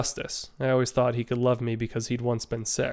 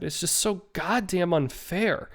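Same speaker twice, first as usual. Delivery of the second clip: higher and slow, close to crying; a slow, breaking cry of unfairness; tearful; 6 semitones higher, slower than usual. Acted. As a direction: drag it out as the tears come. Hazel near tears at news.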